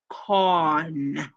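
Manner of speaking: "con" held long as a syllable alone; stressed syllable. Shown as a demonstration. Teacher isolates the second syllable, drawn-out "con". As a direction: draw out "con" by itself. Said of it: "stress on the second syllable".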